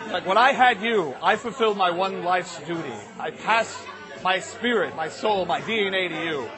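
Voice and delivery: low, measured fatherly speech with pauses; earnest heart-to-heart, weighting each phrase; acted dad solemnly declaring his love.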